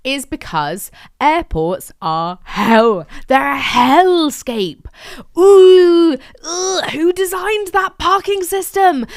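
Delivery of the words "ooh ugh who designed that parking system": high, loud and slow, full of disgust; disgusted groan. Real, not acted. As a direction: groan it out in outrage at the car park. Ranting about airport parking.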